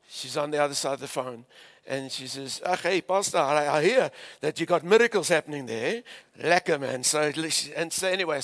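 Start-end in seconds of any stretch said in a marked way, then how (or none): 2.7-7.0 playful imitation of an Afrikaans lady's accent. Afrikaans lady phoning the pastor about miracles, Afrikaans accent. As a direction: put on a thick Afrikaans accent. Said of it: "I love that accent".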